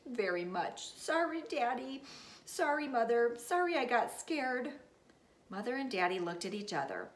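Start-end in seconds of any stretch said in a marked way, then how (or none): 1.0-4.7 sniffly apology. Acted. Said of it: "My nose started to sniff".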